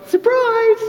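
playful surprise. Retold or imitated. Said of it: "you can almost see Jesus standing there going".

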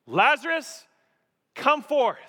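booming shout of Lazarus, come forth; loud command. Retold or imitated. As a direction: call the dead man out of the tomb. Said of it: "Jesus shouts, I believe loudly".

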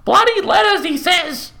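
a mother yelling back into the house. A narrator's reading. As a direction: yell it over her shoulder. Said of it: "she yelled over her shoulder".

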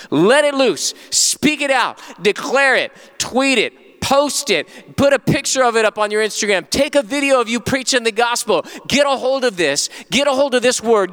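high, punchy exhortation; rally the congregation, each command driven home; rousing preaching. Real, not acted.

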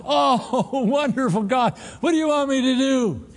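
high, relieved and eager; eager climber. Retold or imitated. Climber answering God from the cliff, high and eager. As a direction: grab at the rescue, high and grateful. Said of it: "climber replies to God".

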